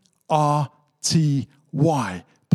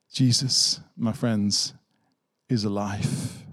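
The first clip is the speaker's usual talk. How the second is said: 1 hushed proclamation; 7 semitones lower, 5 dB quieter, slower than usual. Real, not acted. Quiet climax to the congregation.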